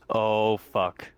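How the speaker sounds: ordinary delivery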